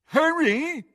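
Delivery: high-pitched mocking call; mocking mimicry. A narrator's reading. Crabbe mocks Ron's call, mimicking. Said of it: "mimicked Crabbe".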